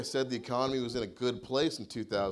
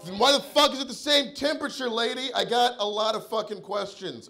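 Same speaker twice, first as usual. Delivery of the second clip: much higher, loud and strained; enraged yell; 9 semitones higher, 5 dB louder than usual. Retold or imitated. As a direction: explode at her. Furious retort at the clerk.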